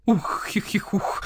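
breathy owl titter; slow hooting giggle; giggling. Acted. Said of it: "захихикал Сыч".

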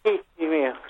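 ordinary talk

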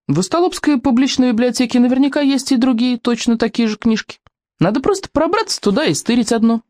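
lightly acted plucky girl; confident girl character. A narrator's reading. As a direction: pitch it up and propose the scheme briskly. Kamikadze proposes stealing a book.

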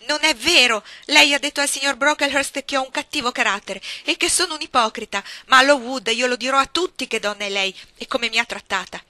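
più alta e più forte, rabbia che cresce; accusa furiosa. A narrator's reading. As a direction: getta ogni parola come un'accusa. Jane's outburst. (Jane accusa la signora Reed, furia)

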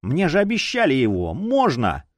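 high, insistent begging; pleading. A narrator's reading. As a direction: beg eagerly for a promised prize. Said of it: "с мольбой посмотрел".

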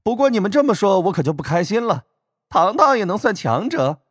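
high, mocking young man with a laugh; teasing laugh. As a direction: laugh and tease sweetly. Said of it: "白若瑶笑道".

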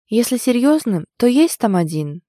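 plain reading voice

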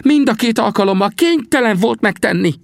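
very high, urgent protest; high-voiced earnest defence; insistent Sam. A narrator's reading. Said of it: "erősködött Sam".

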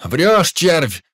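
sharp angry shout. Acted. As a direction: yell it with contempt. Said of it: "крикнул красивый юнец".

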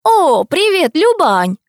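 high-pitched hello called to a neighbour; cheery greeting. A narrator's reading. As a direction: greet her loudly from a distance. Neighbour greets Luba back from afar.

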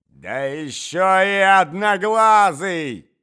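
громкий высокий злой крик; выкрикни оскорбление. Acted. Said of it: "закричала Марья Александровна". (Марья Александровна кричит на князя)

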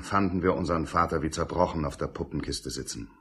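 usual speaking voice

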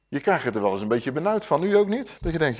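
ordinary talk

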